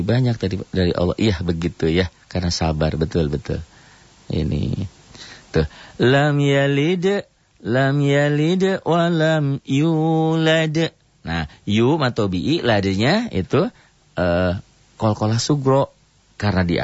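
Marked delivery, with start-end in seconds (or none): 6.0-10.9 slow, high melodic tartil chant; Quran recitation. Shown as a demonstration. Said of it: "teacher recites Quran verse". (lantunan tartil pelan)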